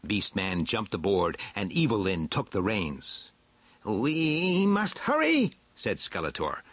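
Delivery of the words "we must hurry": high, drawn-out urgent villain voice. A narrator's reading. Skeletor to his companions at the chariot, urgent. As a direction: press them on, stretching the words high.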